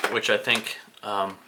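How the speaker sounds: ordinary talk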